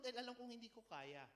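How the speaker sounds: ordinary delivery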